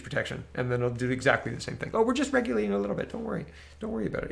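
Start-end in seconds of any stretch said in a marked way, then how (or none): 1.9-4.3 high, fast, sugary mock reassurance. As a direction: parody a smug official soothing the public. Mocking officials' soothing reassurance.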